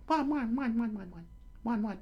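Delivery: very high, rapid falsetto yaps; dog barking. Acted. Dog bares fangs at the lion, high barking. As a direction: yap frantically like a small dog.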